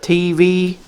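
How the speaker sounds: ordinary talk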